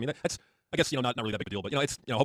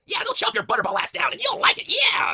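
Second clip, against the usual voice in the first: very high, loud, slow squeak; gleeful tiny henchman; squeaky sidekick; 9 dB louder, slower than usual. Pretend. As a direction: sidekick cheering on the alligator plan.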